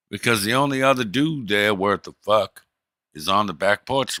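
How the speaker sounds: ordinary talk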